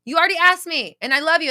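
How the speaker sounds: real high, loud yelling; loving yell. Real, not acted. Reader yelling advice at a viewer out of love. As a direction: shout it at her like a fierce big sister. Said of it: "That's why I'm yelling at you".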